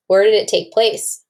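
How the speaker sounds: plain reading voice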